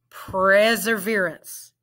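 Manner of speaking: "Perseverance" said smoothly as one word; blended "Perseverance". Shown as a demonstration. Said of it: "Let's blend it".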